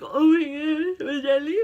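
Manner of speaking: high, loud mock wail; incoherent blubbering. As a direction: wail it as an overwhelmed fan, words barely making sense. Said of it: "wasn't making any sense".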